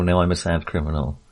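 normal talking voice

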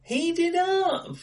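high, slow, surprised; pleasantly startled by the news. Real, not acted. Co-host learns the director made Up, surprised.

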